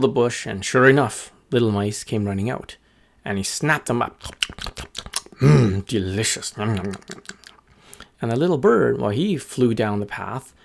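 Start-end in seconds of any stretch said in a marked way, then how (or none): 5.4-7.5 munch with mock relish as the wolf; wolf savoring food. Wolf eating mice.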